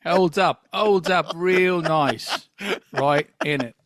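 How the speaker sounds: mock Cockney with stretched words; fake British accent. Fake Biff defends Benny Hill, put-on British accent. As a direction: chat in a hammed-up London accent. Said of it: "I don't know what accent I'm doing now".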